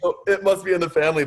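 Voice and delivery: words bubbling with real laughter; laughing. Real, not acted. Laughing to the host about family tears. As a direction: chuckle at the family joke. Laughs after dad cried too.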